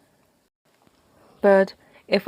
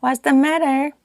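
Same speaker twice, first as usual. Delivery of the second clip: higher-pitched village headman; headman's question; 9 semitones higher, 3 dB louder than usual. A narrator's reading. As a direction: put on a raised, inquiring voice.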